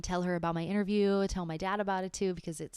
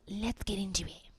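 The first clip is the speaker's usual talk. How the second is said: whispered. Real, not acted. Quiet start to reading.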